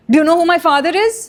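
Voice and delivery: fast, high imitation of entitlement; mock entitled outrage. Mocked quote of entitled threat.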